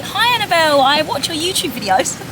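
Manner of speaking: high, bright mock greeting; imitating a keen fan. Vlogger retells valet greeting her, high-pitched imitation. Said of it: "The guy from the valet just came over and was like".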